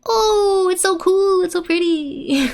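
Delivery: high-pitched gushing admiration; excited squealing. Acted. Girls in the background watching fireworks, squealing excitedly. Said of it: "someone shrieking. It's like".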